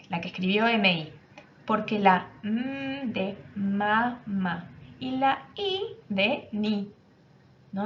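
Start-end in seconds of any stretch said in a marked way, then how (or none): 1.7-6.9 put-on slow, syllable by syllable like a young girl; slow child's answer. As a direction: spell it out slowly, sound by sound. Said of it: "la niña dice". (niña que explica muy despacio)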